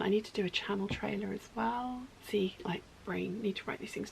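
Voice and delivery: soft unvoiced whisper; whispered note to self. Real, not acted. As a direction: remind yourself under your breath. Whispered reminder to herself.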